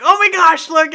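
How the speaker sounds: high, loud excited exclamation; mock-excited outburst, high and loud; excited boss. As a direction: gush loudly, pitch jumping up.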